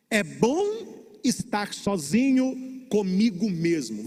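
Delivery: slow, drawn-out model for the congregation; line to repeat aloud. Shown as a demonstration. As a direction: stretch each phrase so the crowd can echo it. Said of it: "Repita alto".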